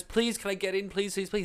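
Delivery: pleading. Retold or imitated. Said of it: "He started crying".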